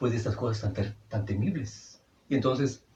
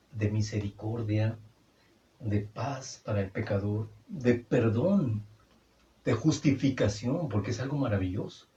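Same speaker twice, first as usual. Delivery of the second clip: normal talking voice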